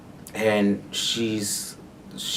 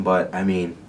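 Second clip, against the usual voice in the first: normal talking voice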